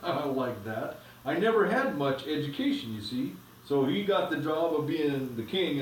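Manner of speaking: put-on steady storybook reading to the kids; reading aloud to children. A narrator's reading. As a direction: read the chapter aloud, evenly paced. Parent reads a story aloud.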